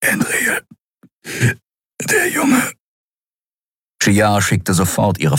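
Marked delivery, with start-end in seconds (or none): 0.0-2.7 a breathless first word, then a sudden high cry on the second; weak injured admiral. A narrator's reading. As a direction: gasp the name out, then cry out the rest. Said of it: "deutete er".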